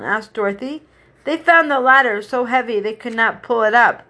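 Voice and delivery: usual speaking voice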